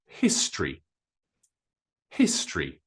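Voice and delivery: history as two syllables, HIS-tree, first stressed. Shown as a demonstration. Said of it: "two syllables".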